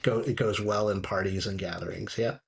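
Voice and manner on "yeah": ordinary talk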